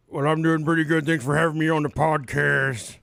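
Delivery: mock [name]. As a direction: lightly play the guest singer. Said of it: "Does he talk like that?".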